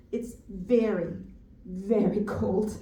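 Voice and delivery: slow, weighty "very, very cold"; slow emphasis. Acted. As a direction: draw out each "very" before landing on "cold".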